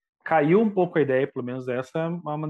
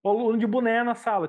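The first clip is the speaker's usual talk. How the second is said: usual speaking voice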